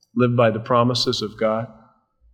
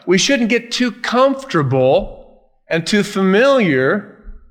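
preaching; 3 semitones higher than usual. Acted. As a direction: lean in and preach it, stretching the key words. Pastor preaching on believers as pilgrims.

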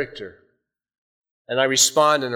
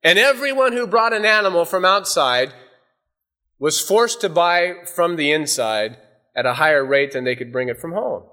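normal talking voice

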